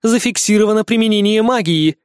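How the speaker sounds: metallic alarm announcement repeated; loud robotic loudspeaker voice; air raid loudspeaker. Acted. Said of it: "железный голос из громкоговорителей".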